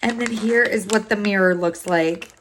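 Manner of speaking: usual speaking voice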